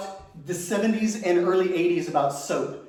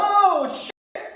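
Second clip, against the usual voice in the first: ouch exclamation; 12 semitones higher than usual. Retold or imitated. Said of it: "I'd step on a tack and be like".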